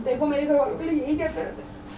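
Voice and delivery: quiet, tearful complaint through crying; hurt and weeping, barely holding it together; soft teary voice, wounded by her husband's words. Real, not acted. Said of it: "तो रो क्यों रही हो?".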